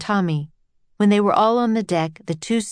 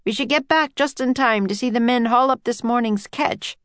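lightly voiced scientist, raised pitch; a higher-pitched, informative character voice; Henry; 6 semitones higher than usual. A narrator's reading. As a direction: read as a scientist chatting to guests.

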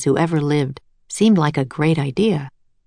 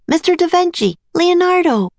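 put-on high-pitched girl calling out; shouted call; 13 semitones higher than usual. A narrator's reading. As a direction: call after him high, without full volume. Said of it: "Annie shouted".

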